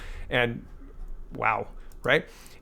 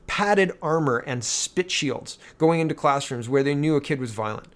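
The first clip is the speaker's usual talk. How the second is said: ordinary talk, nothing marked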